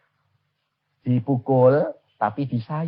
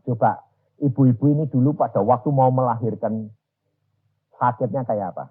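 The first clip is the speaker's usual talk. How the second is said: usual speaking voice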